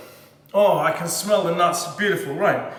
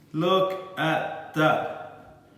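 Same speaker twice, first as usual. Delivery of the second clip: very slow, stretched-out marvel; low, very slow and drawn out; drawn-out awe; 4 semitones lower, 4 dB quieter, much slower than usual. Real, not acted. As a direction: savour every word slowly. Gazing at the cake.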